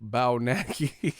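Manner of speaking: slow, laughing mock of the name; laughing mimic. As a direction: drag out the mangled name through a laugh. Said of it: "He's like".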